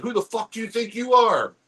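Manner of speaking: high, pushed cop outburst; mock angry cop. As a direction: raise the pitch in a cop's challenge. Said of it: "he's like".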